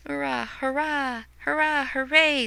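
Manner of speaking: mock crowd cheers; cheering. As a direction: celebrate like the watching world. Crowd celebration read.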